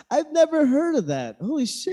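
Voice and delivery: high amazed laugh; surprised. Real, not acted. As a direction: gasp it out in amazement. Amazed at trivia.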